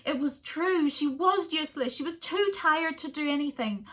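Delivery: usual speaking voice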